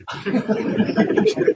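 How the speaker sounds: a breathy, high yelping dog noise; imitated dog yelp. Preacher acting out the struck goat's dog-like yelp. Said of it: "اس نے کتی کی طرح".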